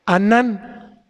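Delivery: "anán" stressed on its last syllable; "anán", weight on the final -nán; stress on second A. Shown as a demonstration. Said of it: "con acento en la segunda A".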